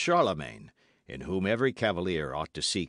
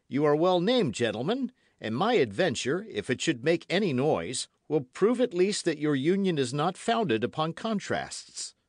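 higher, louder, lively Gascon voice; spirited young D'Artagnan; 8 semitones higher, 4 dB louder than usual. Acted. Young Gascon compliments the three inseparables, spirited. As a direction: answer boldly in a raised young voice.

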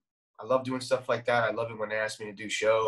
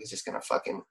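whispered inner doubt; 6 semitones lower, 7 dB quieter than usual. Retold or imitated. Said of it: "in the back of my head".

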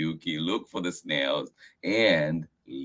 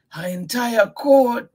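high, loud surprised asking; surprised question; 7 dB louder than usual. Acted. Grandfather surprised at Miyuki's news. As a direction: marvel at the news. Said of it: "Grandfather asked".

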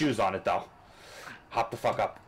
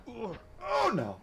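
very high squeaked oh no; sudden high alarmed cry; panicked squeak; 12 semitones higher than usual. Real, not acted. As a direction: yelp as you nearly fall. Nearly falling in game.

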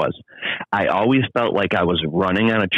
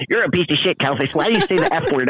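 higher, indignant scolding voice; mock angry critic; 9 semitones higher than usual. Offended listeners scolding Calvin, mock indignant. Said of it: "people get mad and say".

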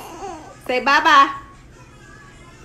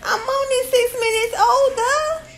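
put-on talking for the baby; 10 semitones higher, 3 dB louder than usual. Shown as a demonstration.

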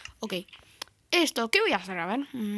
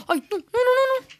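high, loud panicked no's; 12 semitones higher, 8 dB louder than usual. Real, not acted. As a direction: scream no in a panic. Sudden danger in game.

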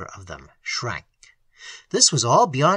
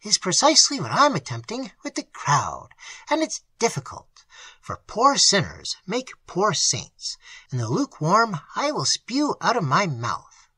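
ardent; 6 semitones higher than usual. A narrator's reading. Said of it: "exclaimed with ardor".

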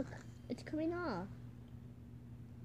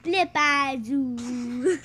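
silly drawn-out voice; 8 dB louder, much slower than usual. Shown as a demonstration. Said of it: "The way you said flip bazoo".

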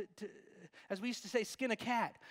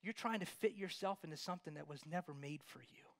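whisper; 3 semitones lower, 6 dB quieter than usual. Real, not acted. Preacher drops to whisper.

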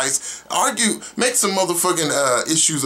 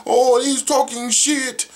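much higher, whiny mock voice; mocking whiners; 12 semitones higher, slower than usual. Mocking fans who whine about Sherman, whiny high mockery. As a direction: mimic crybaby fans, slow and whiny. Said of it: "everybody's whining, talking about".

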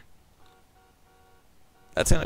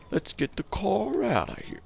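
silly drawn-out voice; 7 semitones higher than usual. Pretend.

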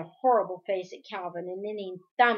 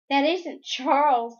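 higher, louder urgent protest; crying out; 5 semitones higher, 6 dB louder than usual. A narrator's reading. Daughter warns father about her brother, crying out. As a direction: cry it out to make him see. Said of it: "Meg cried".

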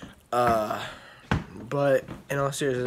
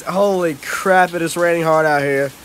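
loud over the rain; 3 semitones higher, 7 dB louder than usual. Real, not acted. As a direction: raise it over the pouring rain. Outside in heavy rain.

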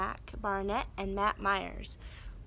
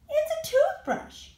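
put-on Ian the boy; 4 dB louder than usual. Ian answers the monsters.

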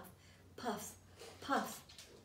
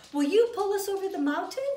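higher and louder pleading; eager cry; 6 semitones higher, 6 dB louder, faster than usual. Acted. Toys beg the blue engine, crying out higher and louder. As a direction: beg with all your heart. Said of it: "cried the dolls and toys".

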